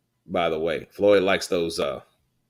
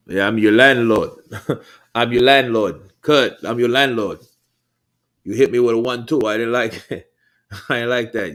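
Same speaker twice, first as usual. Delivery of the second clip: loud, cocky trash talk with laughs; cocky boxer taunt; 4 semitones higher, 7 dB louder than usual. Pretend. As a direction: boast like a fighter calling himself landlord. Voices a boxer taunting opponent.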